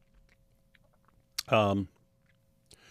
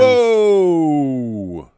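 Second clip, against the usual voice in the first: shocked whoa; 6 semitones higher, 8 dB louder than usual. Real, not acted. Reacting to the retold insult.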